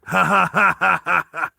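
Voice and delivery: loud mocking belly laugh; Russian villain's laugh. Scarred Russian taunts his teammate, loud villainous laugh. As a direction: laugh loudly like a big Russian heavy. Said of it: "Oh, wait, he's Russian".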